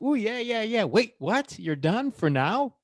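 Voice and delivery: high mock cheer turning to puzzled; excited, then baffled. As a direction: cheer along, then ask in disbelief. Voices cheering crowd, then let down.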